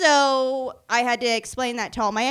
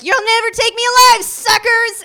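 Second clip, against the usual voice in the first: much higher, louder defiant cry; defiant yell; 11 semitones higher, 8 dB louder than usual. Retold or imitated. Drunk woman resisting the cops, loud and high-pitched. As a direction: yell it like a cornered outlaw. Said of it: "and I was like".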